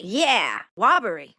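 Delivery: put-on baby voice. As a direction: echo it like a bold toddler. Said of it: "squawked in our best baby voices".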